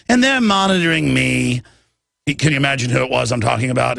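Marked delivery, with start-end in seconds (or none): 0.1-1.6 imitating the spy. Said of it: "And he talks like that".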